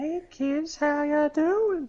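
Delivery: high, slow, creepy "how ya doing"; creepy guy voice. Retold or imitated. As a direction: lure them in, high and slow. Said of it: "that creepy guy's voice from Family Guy".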